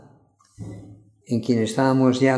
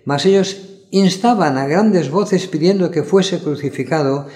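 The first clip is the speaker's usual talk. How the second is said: ordinary delivery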